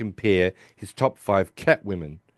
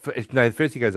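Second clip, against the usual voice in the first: usual speaking voice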